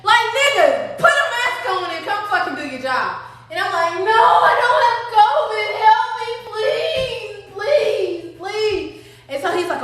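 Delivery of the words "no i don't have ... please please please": high, slow desperate plea; begging. Retold or imitated. Mother in labor begging the medic for help. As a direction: beg him to help, drawn out.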